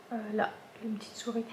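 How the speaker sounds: hushed whisper, quick and breathy; soft quick whisper over the detail. Real, not acted. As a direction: whisper it like sharing a tiny secret. Pointing out a tiny mouse detail.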